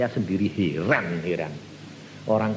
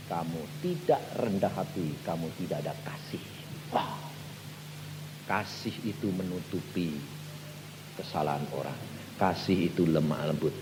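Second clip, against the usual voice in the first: soft voice of God; 8 semitones lower, 11 dB quieter than usual. Retold or imitated. God speaking, retold.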